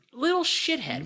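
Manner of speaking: high squeaky little shithead; mock disdain. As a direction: sneer it in a high voice. Mocking disdain for Kyle.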